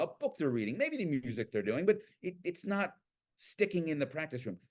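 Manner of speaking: normal talking voice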